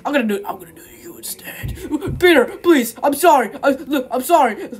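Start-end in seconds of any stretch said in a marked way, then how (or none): 0.1-1.8 fast menacing whisper; quick sinister whisper. Acted. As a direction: hiss the threat quickly under your breath. Menacing aside.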